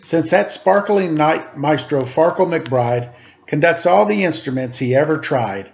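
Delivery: put-on lively read-aloud to children; storybook reading voice; story narration. A narrator's reading. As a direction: read it like a picture book, rhythmic. Reads picture book aloud.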